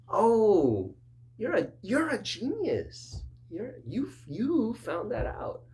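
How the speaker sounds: high, slow, sarcastic congratulations; mock praise. Counselor sarcastically praising the client's discovery. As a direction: overpraise them like a child.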